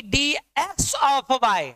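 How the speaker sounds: डी, एक्स of y said slowly, every letter separate; "डी, एक्स of y" pronounced deliberately, letter by letter; correct reading. Shown as a demonstration. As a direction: spell out डी, एक्स of y one syllable at a time. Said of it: "डीवाई डीएक्स के मतलब पढ़ते हैं".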